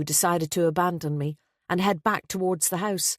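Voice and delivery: usual speaking voice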